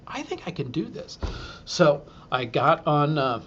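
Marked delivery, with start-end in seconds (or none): 0.1-1.2 hopeful inner voice. Retold or imitated. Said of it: "I was like".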